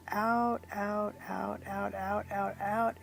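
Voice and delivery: softening repeated outs; fading breathe-out count. Acted. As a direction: let the outs fade like a long exhale. Guiding a long exhale.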